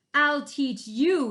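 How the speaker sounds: furious varmint howling; howled. Acted. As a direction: howl the threat in fury. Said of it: "he howled".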